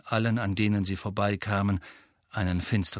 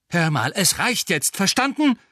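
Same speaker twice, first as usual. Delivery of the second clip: loud, high, exploding in exasperation; angry outburst; 10 semitones higher, 6 dB louder than usual. A narrator's reading. Harry cuts off Ron's self-blame, bursting out. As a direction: burst out, demanding an end to it. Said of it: "platzte Harry heraus".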